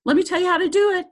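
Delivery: hammed-up high bossy helper; bossy inner voice. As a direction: play the meddling voice that wants to take charge. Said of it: "just like it was out here going".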